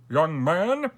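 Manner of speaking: high, loud royal address; stern king. A narrator's reading. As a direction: summon the boy with authority. King summons the condemned boy.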